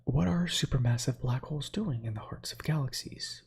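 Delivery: ordinary talk, nothing marked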